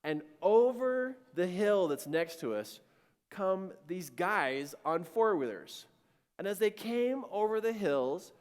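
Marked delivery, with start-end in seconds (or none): none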